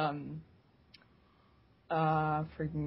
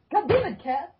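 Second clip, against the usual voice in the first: exasperated outburst; 10 semitones higher than usual. Real, not acted. Cat interrupts her story.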